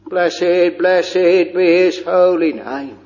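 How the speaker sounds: ordinary delivery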